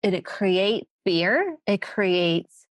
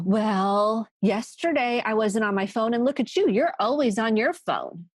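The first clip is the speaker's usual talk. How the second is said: higher-pitched mock comeback; defensive; 4 semitones higher than usual. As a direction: protest and turn the accusation back.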